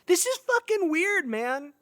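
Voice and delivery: losing his cool over the horse; freaked out. Acted. Friend rattled by the moved horse, very high freaked-out cry.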